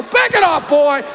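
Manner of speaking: high-pitched, exasperated brush-off; mock scolding. Dad to interrupting son, mock scolding. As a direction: shoo the kid away in mock exasperation.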